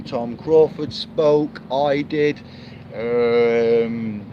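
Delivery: usual speaking voice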